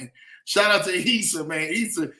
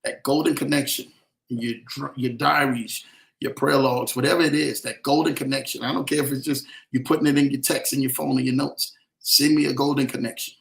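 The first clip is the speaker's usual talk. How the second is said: preaching; 4 semitones lower than usual. Acted. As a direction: preach it like a promise over the crowd. Livestream preaching to viewers.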